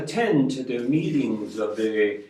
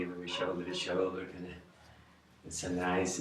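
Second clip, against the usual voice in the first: hushed; 6 semitones lower, 14 dB quieter, faster than usual. Retold or imitated. Cozy with the young woman.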